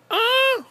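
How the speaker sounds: very high, loud cry at the cold; sharp yelp from the cold. Real, not acted. Cold, nails turning purple.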